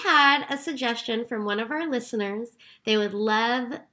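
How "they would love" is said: usual speaking voice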